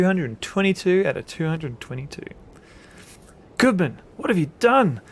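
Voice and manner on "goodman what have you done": loud, high accusing yell. Acted. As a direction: yell it at your enemy in alarm. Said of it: "William Mayfield yells".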